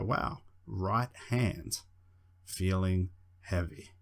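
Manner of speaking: hypnotic voice. Shown as a demonstration. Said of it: "It's all about delivery".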